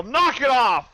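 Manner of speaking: loud, high commanding yell. Acted. Player yelling to intimidate goblins, loud high shout. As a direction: yell it to break up a crowd. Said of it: "I'm gonna just go yell".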